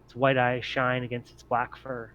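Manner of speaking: ordinary talk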